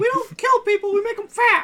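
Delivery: very high, loud cartoon falsetto; squeaky put-on mascot voice; Mickey Mouse. Fast-food mascot boasting about his burgers, squeaky cartoon voice. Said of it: "sound like fucking Mickey Mouse".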